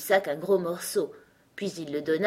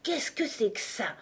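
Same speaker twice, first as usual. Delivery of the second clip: higher voice with a touch of disgust; disgusted unicorn; 5 semitones higher, 4 dB quieter than usual. A narrator's reading. Unicorn first noticing Alice, disgusted. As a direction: ask with understated distaste. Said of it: "d'un air de profond dégoût".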